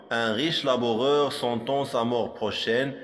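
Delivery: usual speaking voice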